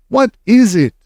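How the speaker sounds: high alarmed call; witches crying out. A narrator's reading. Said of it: "the others cried".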